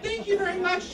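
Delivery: high-pitched, put-on politeness; mock gratitude. Teacher thanks insulting student, mock grateful. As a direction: thank him with exaggerated courtesy.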